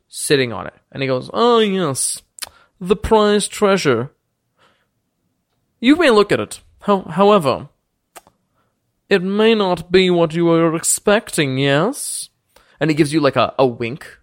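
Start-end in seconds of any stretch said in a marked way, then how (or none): 1.3-12.3 higher, pompous noble voice, suddenly gracious; boastful lord Thaddeus. Acted. Lord welcomes the party after the deception, pompous and higher. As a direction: play a smug ancient lord welcoming guests. Thaddeus shows prized flute.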